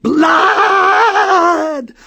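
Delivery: very high, loud cry; shrieked blood. A narrator's reading. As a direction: scream it in alarm. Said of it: "mother yelled".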